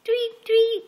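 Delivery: high, light tweet tweet in a chirpy bird voice; birdie chirp. Pretend. Mother chirps birdie sounds to her toddler, high.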